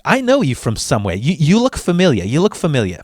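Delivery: put-on clerk's Italian-American accent; put-on Italian-American accent of a DMV lady; Italian-American lady's accent. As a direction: imitate an older Italian-American woman's accent. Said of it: "in her, in her fucking act".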